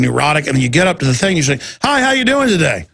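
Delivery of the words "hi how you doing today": bright high-pitched hello to a clerk; chirpy, raised-pitch customer greeting; friendly greeting. Retold or imitated. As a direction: cheerfully greet someone behind a counter.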